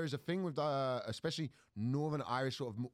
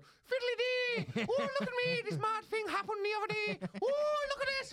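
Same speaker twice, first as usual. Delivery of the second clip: very high, hammed-up Irish squeal; gleefully mock twee Irish comedy; caricature Irish comedian; 3 dB louder than usual. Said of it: "Irish comedy is just people going".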